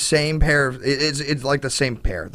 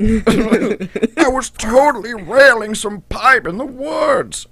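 frat bro; 7 semitones higher, 4 dB louder, much slower than usual. Retold or imitated. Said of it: "the emphatic frat boy".

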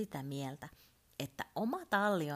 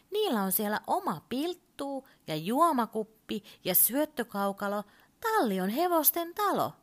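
climbing higher and louder as he explains; the voice lifts more with each item, ending high; voice rising; 7 semitones higher, 6 dB louder than usual. Acted. Urpo explains stables to Turpo, voice rising higher and louder. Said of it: "ja hänen äänensä kohosi hieman".

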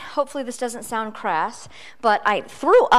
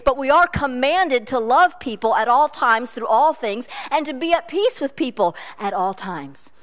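usual speaking voice

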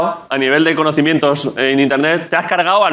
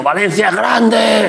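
put-on higher, drawn-out cry of an obsessed old man; crazed angry old man; 4 semitones higher, much slower than usual. Host acting out the threatening old man, crazed drawn-out cry. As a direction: cry it out slowly like a menacing elder. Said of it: "con los ojos inyectados en sangre diciendo".

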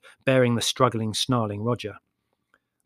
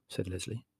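usual speaking voice

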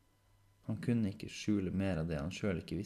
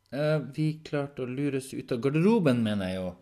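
louder, slightly flustered self-correction; 7 semitones higher, 10 dB louder than usual. Acted. Henrik correcting his own slip, mildly flustered.

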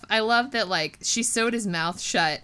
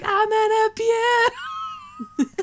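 very high, slow drawn-out wail while laughing; dizzy bully wail; 13 semitones higher, 3 dB louder, much slower than usual. Retold or imitated. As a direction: stretch it out high like you're spinning helplessly. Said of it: "he's like".

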